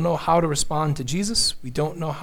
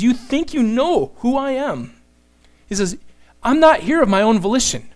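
higher and louder, a Jesus who cries out in anger; frustrated angry outcry; 5 semitones higher, 4 dB louder than usual. Retold or imitated. Preacher voicing Jesus crying out at the arrogant crowd, angry and raised. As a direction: throw the words back at the crowd, indignant. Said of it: "I think it's in frustration and in anger".

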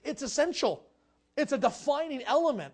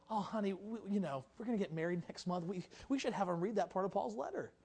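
sweet engaged couple; 4 semitones lower, 8 dB quieter than usual. Pretend. Corinthian couple planning their wedding.

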